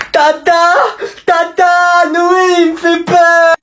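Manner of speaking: put-on very high child voice calling auntie; small cousin calling auntie for help, high kid voice; little kid crying out. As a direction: wail for his aunt like a scared small boy. Kid cries out to aunt.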